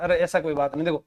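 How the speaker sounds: ordinary talk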